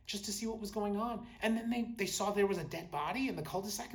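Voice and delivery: ordinary talk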